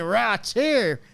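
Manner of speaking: high, loud, slow Southern drawl; mock Southern-British accent. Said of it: "a Southern and British accent".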